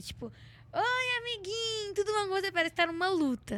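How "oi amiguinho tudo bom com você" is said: cutesy sweet voice. Retold or imitated. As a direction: coo it like to a little friend. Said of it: "Pessoa que conversa batendo".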